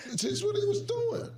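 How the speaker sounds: high, slow, teary laughter; wheezing laugh. Real, not acted. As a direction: squeeze it out slowly through laughter.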